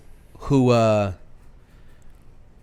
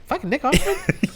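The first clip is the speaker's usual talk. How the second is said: high, laughing disbelief at a cameo; incredulous double take; 12 semitones higher, 4 dB quieter than usual. Retold or imitated. Recalling spotting a surprise cameo, high laughing disbelief. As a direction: gasp out his name in laughing shock. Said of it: "do a double take and be like".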